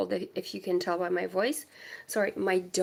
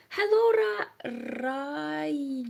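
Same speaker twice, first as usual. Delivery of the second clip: much higher, slow drawn hello; sing-song hello; 6 semitones higher, much slower than usual. Real, not acted. Sing-song greeting to a viewer by name. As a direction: stretch the greeting up high.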